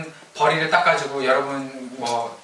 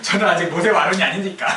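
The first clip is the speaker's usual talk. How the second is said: raised pitch, words shaken by laughter; real amused laughter under the words; laughing excuse; 4 semitones higher, 4 dB louder than usual. Real, not acted. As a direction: explain while still cracking up. Laughing excuse for own mock prayer.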